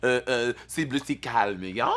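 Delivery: put-on French accent with bits of French; playful mock-French host patter. Shown as a demonstration. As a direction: have a go at a hammed-up French accent. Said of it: "let me try my French accent".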